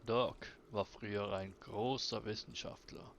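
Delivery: somber storyteller figure. Acted. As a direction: tell of the lost father, low and heavy. Voices character telling backstory.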